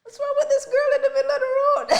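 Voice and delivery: very high, loud, fast mocking quote through laughter; onlookers' puzzled question, shrieked with laughter; laughing mock onlookers. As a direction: squeal the bystanders' question while laughing. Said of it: "people were just looking at me like".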